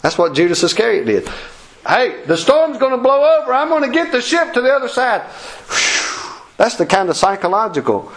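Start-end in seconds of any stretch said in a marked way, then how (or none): 1.9-5.2 high-pitched, lively call; raised-pitch announcement to the crew; calling out reassurance. Acted. Acting out reassurance in the storm. 5.3-6.4 long airy exhale of relief; soft, voiceless whoosh of breath; relieved phew. Acted. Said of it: "did you go".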